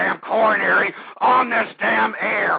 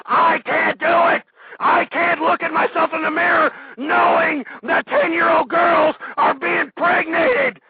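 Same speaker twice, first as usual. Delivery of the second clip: angry ranting shout; 8 semitones higher than usual. Real, not acted. Host yelling he can't stand the country's state, furious. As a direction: rage at them, voice straining.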